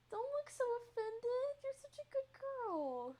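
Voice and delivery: very high, drawn-out cooing. Real, not acted. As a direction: coo to a cat in a tiny high voice. Cooing at offended cat.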